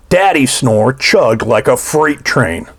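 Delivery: ordinary delivery, nothing marked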